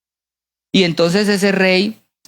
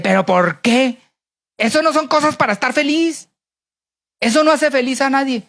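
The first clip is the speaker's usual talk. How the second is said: mock-indignant king, pitched up; angry king; 6 semitones higher than usual. King challenging his happy servant, mock angry. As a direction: protest in annoyance, mildly hammed-up. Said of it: "el rey todo enojado le dice".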